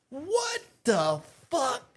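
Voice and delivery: high, very slow, stretched-out shock; stunned disbelief. Retold or imitated. Teller reacting to the man with a bat, stunned. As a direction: draw each word out high in mock disbelief.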